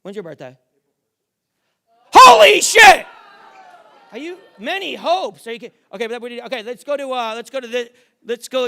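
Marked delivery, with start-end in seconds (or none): 2.1-3.1 much higher, much louder burst of amazement; stunned shout. Real, not acted. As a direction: blurt it out, floored by the reveal. Magic trick reveal stuns him.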